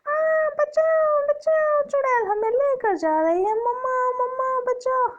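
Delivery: put-on a child wailing for mama as the witch grabs it; screaming children. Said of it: "उसके बच्चे चिल्ला रहे हैं". (बच्चे मम्मा को पुकारते हुए, डर से ऊँची चीख)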